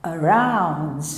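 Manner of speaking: "around" com A inicial reduzido a um "â" átono; schwa inicial fraco. Shown as a demonstration. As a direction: passe leve pelo A e apoie em "round". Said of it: "É um som bem fraquinho". ("around" grave, com a primeira vogal fraquinha)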